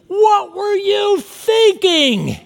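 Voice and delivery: high, slow, loud exasperated mom; mother demanding an answer; scolding parent. Acted. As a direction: scold a five-year-old, drawn out. Parent scolds child in story.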